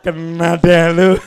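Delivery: gloating devil laugh. Acted. Devil mocking the relapsed smoker, laughing. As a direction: gloat like a devil who caught his prey.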